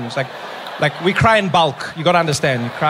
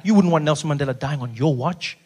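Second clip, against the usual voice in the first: normal talking voice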